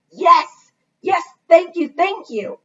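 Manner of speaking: happy ghost; grateful ghost. A narrator's reading. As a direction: burst out with thanks.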